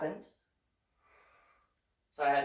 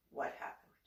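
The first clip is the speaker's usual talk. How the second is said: whispered, gentle probing; soft whisper; 8 dB quieter than usual. Retold or imitated. Mom gently pressing her son about the fight, whispered. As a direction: ask it in a hushed whisper.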